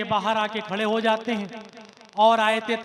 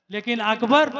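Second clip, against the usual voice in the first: usual speaking voice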